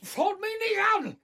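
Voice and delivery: angry shout. Acted. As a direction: fight her off, shouting. Confused father resists being moved.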